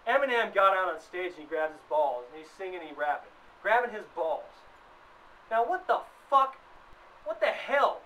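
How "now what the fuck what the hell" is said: usual speaking voice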